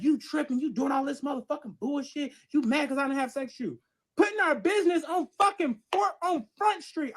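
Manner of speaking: high-pitched angry retold rant; heated, indignant accusation; going off. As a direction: act out him scolding her, worked up. Boyfriend goes off in public.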